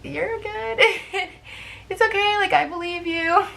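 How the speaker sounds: a hint of kind strangers saying it's okay; reassuring strangers. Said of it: "and they were like".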